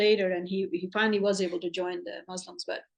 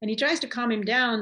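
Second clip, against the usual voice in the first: usual speaking voice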